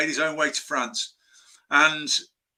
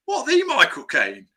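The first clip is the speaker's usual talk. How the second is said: mildly incredulous, higher and drawn-out; amazed reaction; 6 semitones higher than usual. Retold or imitated. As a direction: imitate your own astonished double-take. Reacts amazed to star's interest.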